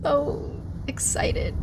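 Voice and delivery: slow breathy whisper of mock excitement; sarcastic hushed so excited. Real, not acted. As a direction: whisper it, slowly and dryly. Sarcastic aside after laughing.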